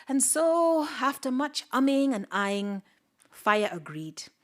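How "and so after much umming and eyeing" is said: ordinary delivery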